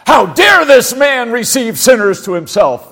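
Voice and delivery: loud, scornful outcry; indignant Pharisee shout. Retold or imitated. Pharisees scorning Jesus for receiving sinners, indignant shout. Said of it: "They're like".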